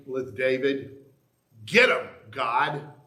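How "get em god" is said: loud, drawn-out plea to God; punchy prayer cry, rising at the end; rousing call. Acted. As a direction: call it out slowly and forcefully. Preacher calls on God against evil.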